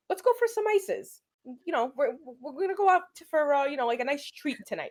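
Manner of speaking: high-pitched, mock-casual suggestion; coaxing invitation. Woman retells luring her boyfriend out for ices, coaxing.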